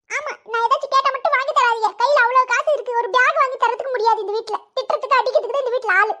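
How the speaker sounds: put-on boy whining a complaint at his mother; whiny child. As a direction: sulk and whine like a kid denied a bag.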